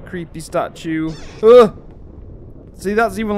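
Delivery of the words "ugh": loud, jolted grunt; startled grunt. Real, not acted. Player jolted by a noise near creepy statue, startled. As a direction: recoil with a sharp ugh.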